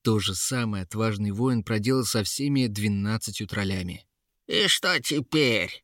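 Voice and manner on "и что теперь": high, drawn-out troll-woman voice; Yera mocking. A narrator's reading. Troll-woman jeers at the guard, slow high mockery. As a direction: taunt slowly in a creature voice. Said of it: "засмеялась Ера".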